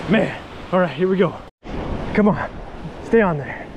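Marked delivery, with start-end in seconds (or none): none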